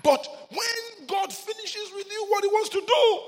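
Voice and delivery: high, loud shouted sermon; preacher crying out how hard rejection feels; preaching at full force. Acted. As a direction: hammer each short line home.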